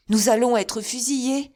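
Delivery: loud, high cry of alarm. A narrator's reading. Raoul crying out as the soldiers take aim. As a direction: shout the danger to his father. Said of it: "s'écria Raoul".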